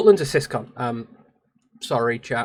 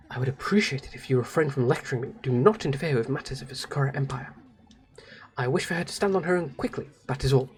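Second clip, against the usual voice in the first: stern game character; 7 dB quieter than usual. Acted. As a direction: coolly refuse to be lectured.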